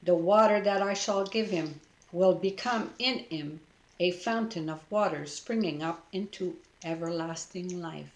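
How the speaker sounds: ordinary delivery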